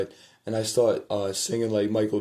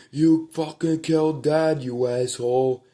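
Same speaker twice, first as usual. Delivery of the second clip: angry shout; 6 semitones higher, 5 dB louder than usual. Retold or imitated. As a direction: hurl the accusation at him. Shouting at brother retold.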